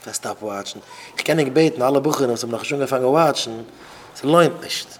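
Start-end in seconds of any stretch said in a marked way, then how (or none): 0.0-0.8 low, soft "Was stop watching?"; hushed, low-pitched questioning echo; quiet echo. Shown as a demonstration. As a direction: drop the voice right down.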